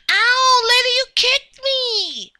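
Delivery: high, indignant yelp; hammed-up hurt whine; pained complaint. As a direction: cry out and accuse whoever hurt you. Kicked by a lady.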